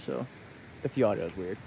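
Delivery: muffled under a helmet; talking through a helmet visor; inside a helmet. Real, not acted. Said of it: "I still have the helmet on".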